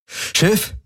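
understated call to the ship's computer. A narrator's reading. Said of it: "rief Garland".

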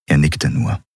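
ordinary delivery, nothing marked

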